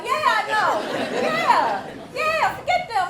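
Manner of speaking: high loud laughing agreement; laughing husband. Retold or imitated. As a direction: laugh it off loudly.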